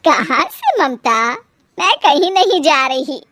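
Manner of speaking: gentle words breaking into a laugh; smiling reassurance. Acted. Mother-in-law reassuring her crying daughter-in-law, amused. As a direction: say it with a smile, then chuckle. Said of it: "सास मुस्कुराकर कहती है".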